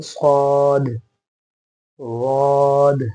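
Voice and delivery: long, loud सौद and वाद with bounced endings; rolled-tongue heavy sound. Shown as a demonstration. Teacher modelling letters for students, सौद and वाद drawn out heavily. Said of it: "ज़बान रोल करके".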